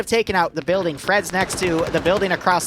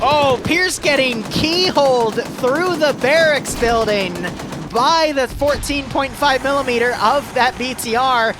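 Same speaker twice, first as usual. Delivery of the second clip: excited play-by-play; 4 semitones higher than usual. Real, not acted. Caster to viewers as a player gets keyholed, excited. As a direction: call the big kill with a jolt of excitement. Caster calls a sudden kill.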